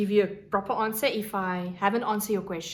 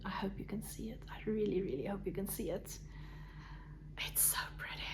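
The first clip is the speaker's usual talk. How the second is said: whispering; 14 dB quieter, faster than usual. Real, not acted. Admiring fiber in hushed awe.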